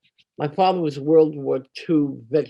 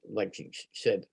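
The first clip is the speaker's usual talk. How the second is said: ordinary delivery, nothing marked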